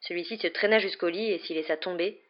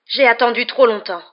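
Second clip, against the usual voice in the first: raised, tense hissed menace; louder, higher threatening hiss; 5 semitones higher, 9 dB louder than usual. A narrator's reading. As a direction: force it out in a hiss. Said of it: "dit-il dans un sifflement".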